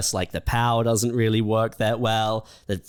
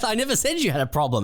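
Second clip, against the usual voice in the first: high fast retort; 10 semitones higher, much faster than usual. Retold or imitated. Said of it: "It's like".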